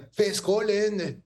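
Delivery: Scottish accent, high, slow, drawn out; accent écossais. Shown as a demonstration. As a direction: exaggerate the Scottish accent on Fé Scotland. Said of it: "Il faut dire vraiment avec l'accent". (accent écossais appuyé, aigu et lent)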